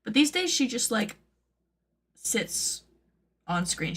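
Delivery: normal talking voice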